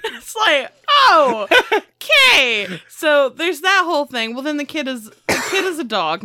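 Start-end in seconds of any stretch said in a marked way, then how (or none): 1.1-2.8 loud, stretched-out mock acceptance; mock taken aback. Mother hearing about the killed family, mock taken aback. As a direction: draw it out slowly and loudly, half laughing. Reaction to absurd murder confession.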